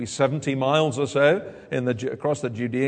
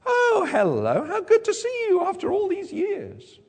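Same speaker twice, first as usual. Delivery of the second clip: gushing social greeting. Acted. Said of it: "She doesn't say".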